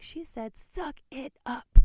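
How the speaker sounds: hammed-up shouted order; mock bark. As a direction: belt it like a kid mimicking a tough mom. Son quoting mom at church.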